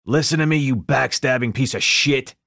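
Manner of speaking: raging gangster yell; furious shout. A narrator's reading. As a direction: bellow it like a furious mob boss.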